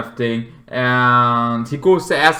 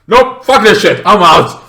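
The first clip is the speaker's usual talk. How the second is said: a higher, loud, panicked exit line; panicked bail-out; 6 semitones higher, 9 dB louder than usual. Retold or imitated. The man fleeing the cult, shouting as he bails. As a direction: yell it as you bolt. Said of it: "and then it's like".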